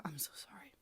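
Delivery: playful whispered sorry; mock whispered apology. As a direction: whisper a fake sorry you don't mean.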